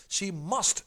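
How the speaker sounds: "must" hit loud and firm; stress on must. Shown as a demonstration. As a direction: land hard on "must" as the key word. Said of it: "The tip in this one is the word must".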